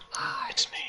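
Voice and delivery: breathy whisper into the mic. Real, not acted. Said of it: "Why are you whispering?".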